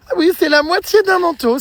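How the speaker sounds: high, sudden fake surprise; mock surprise. Daughter mocking the coat with high fake surprise.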